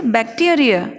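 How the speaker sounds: बैक्टीरिया as "bak-TEE-uh-ree-uh"; teacher's prompt "bak-TEE-uh-ree-uh"; model for repeating. Shown as a demonstration. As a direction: lead learners through बैक्टीरिया, stress on TEE. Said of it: "मेरे साथ बोलिए".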